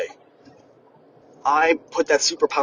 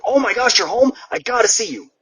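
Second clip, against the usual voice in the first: higher and louder, gushing "you're home"; excited friend; 8 semitones higher, 3 dB louder than usual. Retold or imitated. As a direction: play the eager friend who just heard you're back. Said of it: "every friend ... is like".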